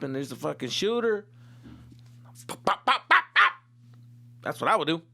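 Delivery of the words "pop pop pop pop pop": high, loud popping gunshot sounds; mock gunshots. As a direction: fire off pop pop like a pistol. Imitating gunshots.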